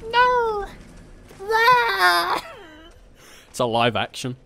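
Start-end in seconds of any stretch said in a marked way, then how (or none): 0.1-2.4 high scream. Acted. Crucifixion scream.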